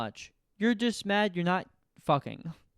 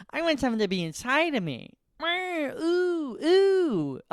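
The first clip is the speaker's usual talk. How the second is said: high moaning ooh, lustful; horny voice; 9 semitones higher, 4 dB louder, slower than usual. Retold or imitated. Adult at home alone, high needy moaning voice.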